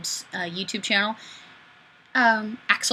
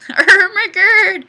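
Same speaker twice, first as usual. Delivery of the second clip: slow, loud, high distorted wail; hammed-up Ermagerd; 10 semitones higher, 10 dB louder, slower than usual. Streamer reading a viewer's comment as a goofy Ermagerd wail.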